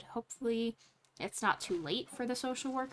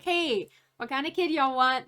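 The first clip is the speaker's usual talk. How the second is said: breezy, casual social worker voice, raised; a quick offhand ask from the social worker; mock social worker; 3 semitones higher, 5 dB louder than usual. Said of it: "the social worker's like".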